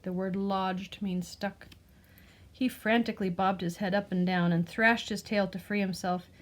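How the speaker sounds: ordinary delivery, nothing marked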